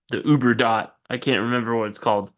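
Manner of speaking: usual speaking voice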